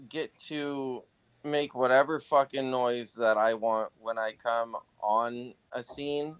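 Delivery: ordinary talk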